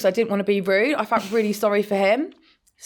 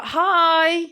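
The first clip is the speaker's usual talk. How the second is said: bright fake hi; 8 semitones higher than usual. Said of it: "I was like".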